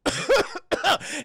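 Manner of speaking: high, loud acted coughing fit; imitated choking cough. Kane suddenly coughs while eating, imitated choking.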